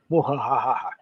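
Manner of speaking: evil villain cackle; mad scientist laugh. Pretend. Sinister tone, spooky joke.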